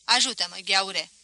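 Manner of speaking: put-on loud, curt command to the boy; café keeper ordering the boy, loud and curt; gruff request. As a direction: order him to carry it.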